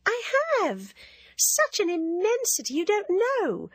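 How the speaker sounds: a coquettish schoolgirl needling her friend; teasing Ginevra. Acted.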